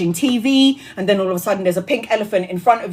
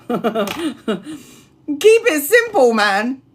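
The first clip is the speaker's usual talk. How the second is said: laughing plea; 3 semitones higher, much slower than usual. Real, not acted.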